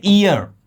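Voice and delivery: ईयर begun straight on the ee vowel; silent-H pronunciation. Shown as a demonstration. Teacher showing how it is pronounced, ईयर without the h. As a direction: say ईयर with no h at the start. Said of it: "इसे pronounce करते हैं".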